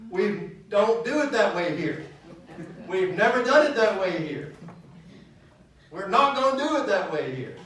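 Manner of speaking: stubborn church refrain. Retold or imitated. Preacher imitating a congregation resisting change, loud and stubborn. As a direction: bang out each refusal like a stubborn deacon. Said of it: "the most dreaded words in church".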